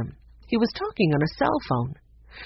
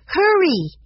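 loud, high call to hurry; high-pitched excited shout; urgent cry; 12 semitones higher, 6 dB louder than usual. Acted. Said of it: "Violet cried".

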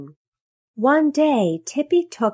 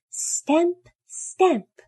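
high-pitched, separated stamps; stamping sound word; 10 semitones higher than usual. A narrator's reading. Baby elephant stamping on a hat, high-pitched narrated stamps. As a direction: pitch each stamp up high, with a gap between.

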